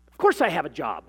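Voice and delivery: loud, indignant snap; snapped retort. A narrator's reading. Mother answers the licensing clerk, indignant snap. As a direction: snap back, offended by the question. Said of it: "Emily snapped".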